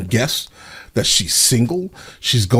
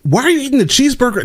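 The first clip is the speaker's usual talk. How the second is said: high, fast disbelieving question; incredulous; 13 semitones higher, faster than usual. Real, not acted. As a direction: squeal it in disbelief. Reacting in disbelief to clip.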